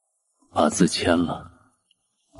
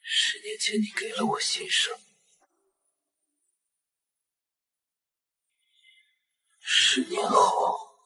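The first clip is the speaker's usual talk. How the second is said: soft, breathy, possessive; menacing hush; 5 dB quieter than usual. Acted. Obsessed man telling her she can never leave, menacing hush. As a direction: whisper it like a promise.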